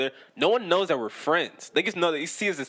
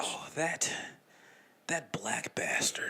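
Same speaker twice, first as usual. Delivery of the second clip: softer, slow, hushed and scandalized; mock whisper; 8 dB quieter, slower than usual. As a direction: hiss it like gossip behind his back. Mock hushed gossip about him.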